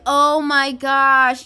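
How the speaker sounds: put-on teen fan overwhelmed at seeing a celebrity, slow loud gush; freaking out. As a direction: gush it slowly like a fan meeting a star. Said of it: "Taylor is probably gonna freak out".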